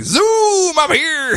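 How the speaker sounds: hammy villain entrance. Retold or imitated. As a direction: burst in like a cartoon villain. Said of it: "this Professor Zoom is just like".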